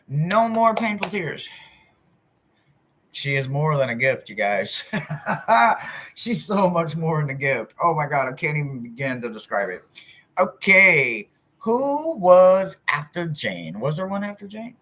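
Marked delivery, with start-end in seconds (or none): 0.1-1.5 slower, higher, stressing more; slow emphatic affirmation; slow earnest mantra. Real, not acted. Repeated mantra. 10.4-13.8 stretched slowly, puzzled; slow musing question; slow drawn question. Real, not acted. Slow reading of chat.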